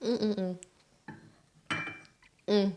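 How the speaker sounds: ordinary talk, nothing marked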